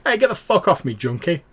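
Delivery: high, loud furious yell at a beggar; angry shout. Acted. Stranger yelling at a junkie who touched him, furious shout. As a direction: bark it at the junkie who grabbed you. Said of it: "you hear a voice go".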